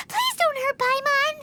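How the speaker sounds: ordinary talk, nothing marked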